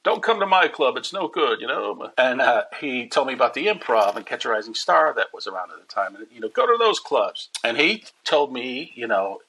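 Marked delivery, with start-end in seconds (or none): 0.0-2.1 Rodney Dangerfield impression. Rodney quoted on phone. 6.5-7.5 Rodney Dangerfield impression. Rodney quoted on phone.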